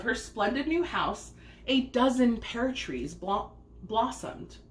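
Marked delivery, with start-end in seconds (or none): none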